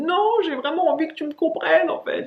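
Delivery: high, pressing plea; eager insistence. Retold or imitated. To English people, a high eager insistence. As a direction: insist you want to be understood.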